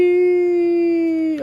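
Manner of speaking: excited whee. Real, not acted. Sliding down in game.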